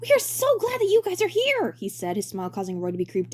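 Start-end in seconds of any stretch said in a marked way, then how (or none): 0.0-1.8 bright high showman voice; high cheery director voice. Acted. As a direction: play the grinning director, way up high. Director character voice.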